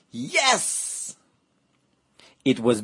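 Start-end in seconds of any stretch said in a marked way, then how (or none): 0.1-1.2 thrilled yes. Acted. Fly finds the picnic.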